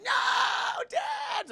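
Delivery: very high, loud drawn-out shout; hammed-up shrieking daughter; retold scream. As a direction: scream for your father in mock anguish. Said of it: "Like, she's screaming and shit".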